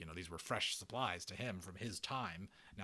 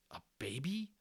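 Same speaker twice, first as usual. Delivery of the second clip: breathless whisper; 8 semitones higher than usual. Acted. Omri astonished.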